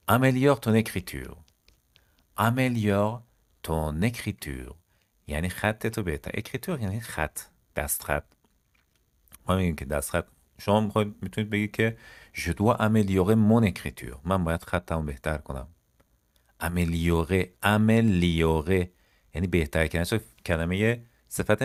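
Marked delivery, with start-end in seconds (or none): 2.4-4.7 "Améliore", long pause, drawn-out "ton écriture"; slow right down on "ton écriture"; slow and split. Shown as a demonstration. Teacher giving learners the French phrase, slowly in parts. 17.6-18.9 "Améliorer" stretched out very slowly; syllable by syllable. Shown as a demonstration. Teacher repeating a new verb for learners, slowly by syllable.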